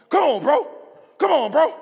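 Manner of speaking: high, loud pushy cheer; loud gym bro pushing his friend; hype shout. Retold or imitated. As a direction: egg him on like a hype man. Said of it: "he goes".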